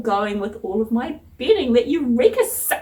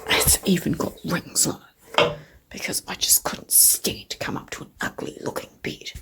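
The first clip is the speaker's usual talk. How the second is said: quiet late-night whisper; 3 semitones lower, 4 dB quieter than usual. Real, not acted. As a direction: keep it hushed, barely voiced. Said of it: "I have to whisper".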